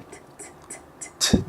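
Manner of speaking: whispered crisp "T, t, t, t." tongue hidden; quick voiceless T taps behind the teeth; repeated T sounds. Shown as a demonstration. Therapist modelling the T sound for parents. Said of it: "tongue is inside the mouth".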